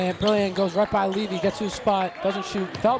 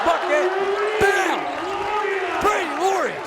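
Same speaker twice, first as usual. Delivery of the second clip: high, loud hype yell; excited shout; 12 semitones higher, 4 dB louder, much slower than usual. Real, not acted. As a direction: shout the bucket like a fan. Spin-move basket, live call.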